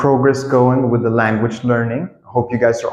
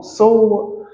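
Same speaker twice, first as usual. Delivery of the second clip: high-pitched, sheepish question; polite re-asking; slower than usual. Shown as a demonstration. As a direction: ask high and politely, as if you forgot. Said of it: "you say it like this".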